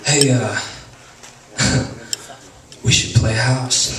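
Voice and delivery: low, slow would-be seductive murmur; awkward low seductive line.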